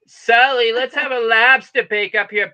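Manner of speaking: lightly voiced Maine local calling out to Sully; Maine accent. Joking about Northeast accents, a light Maine accent. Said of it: "the Northeast has accents".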